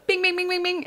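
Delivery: high sung bell dings. Retold or imitated. To viewers, mimicking the subscribe bell with high dings. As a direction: chime like a little notification bell. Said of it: "hit that little bell".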